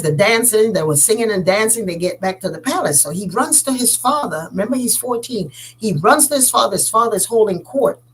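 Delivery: usual speaking voice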